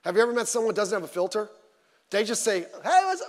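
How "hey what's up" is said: hammed-up high blurt; mock blurt. Mocking a friend with no filter. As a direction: blurt it out way too brightly.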